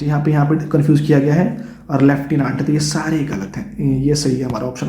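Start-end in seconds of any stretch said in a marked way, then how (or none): none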